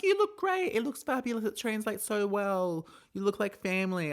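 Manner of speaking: high, gushing falsetto mocking the judges; sarcastic parody of fawning judges; gushing judges impression. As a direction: swoon over the look in a squealing high voice. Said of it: "They're like".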